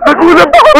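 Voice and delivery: high, loud words through laughter; real startled laugh while talking; laughing from shock. Real, not acted. Companion reacts to the snake scare, laughing loudly.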